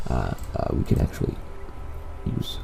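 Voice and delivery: drowsy, half-asleep mumble; sleepy, barely awake voice. Real, not acted. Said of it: "Sorry, I'm, like, half asleep, guys".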